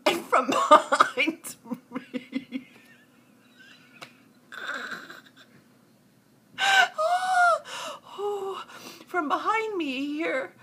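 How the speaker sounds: high, slow words dragged through laughter; real helpless laughter. Real, not acted. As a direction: barely get it out for laughing. Can barely get the words out.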